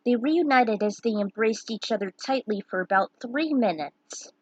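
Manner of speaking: ordinary delivery, nothing marked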